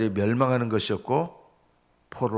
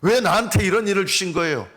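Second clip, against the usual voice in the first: quick, higher-pitched acted grievance; protesting why me in prayer; resentful complaint; 6 semitones higher, 4 dB louder, faster than usual. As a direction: throw the blame up at God. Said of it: "하나님을 원망하는 거예요".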